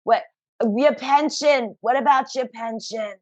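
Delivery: alarmed mother fretting over the pension; mother's Brooklyn voice. Retold or imitated. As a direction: scold like a worried Brooklyn mother. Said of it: "this is how I use her voice".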